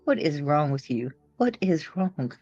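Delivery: ordinary talk, nothing marked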